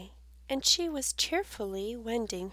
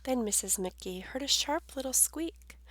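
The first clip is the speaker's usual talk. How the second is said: usual speaking voice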